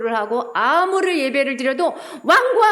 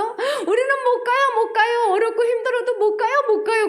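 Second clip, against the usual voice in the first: higher, whining mock sadness; whiny sad refusal; 9 semitones higher, 3 dB louder than usual. Israelites refusing to go, in a higher mock-sad whine. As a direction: whine like a sulking child refusing to go. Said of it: "슬퍼하면서 뭐라 그랬어?".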